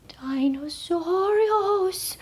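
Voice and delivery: slow, breathy high whisper. A narrator's reading. Little dogs whispering a warning, slow whisper. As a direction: hush it in fear. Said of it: "whispered the perritos".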